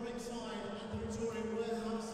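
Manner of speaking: booming ring announcer build to the name. Real, not acted. Ring announcer to the arena crowd, building and booming.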